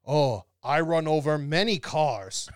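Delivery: put-on Mahmoud's slow Egyptian-accented English; imitated Egyptian accent.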